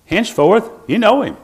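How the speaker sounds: high, loud proclamation; preached emphasis. Retold or imitated. Preacher declaring Jesus' words to the congregation, high and loud. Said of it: "he said".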